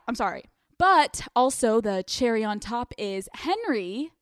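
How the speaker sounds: usual speaking voice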